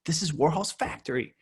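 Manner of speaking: high-pitched, wonderstruck exclamation; amazed realization. Retold or imitated. Coach seeing child play at home, high amazed realization. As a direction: marvel as the dream comes true.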